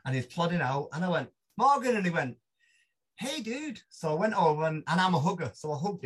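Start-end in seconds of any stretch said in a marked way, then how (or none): 1.6-2.0 loud, high call of a name. Retold or imitated. Storyteller calls to Morgan at arrivals, calling out. Greeting him across airport arrivals. 3.2-3.8 high, soft drawn greeting; Morgan Strebler. Retold or imitated. Morgan greets a stranger at arrivals, high mock greeting.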